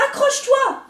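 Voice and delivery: high, loud urgent call; urgent shout. A narrator's reading. Snake fleeing, high loud urgent. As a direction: yell it while fleeing.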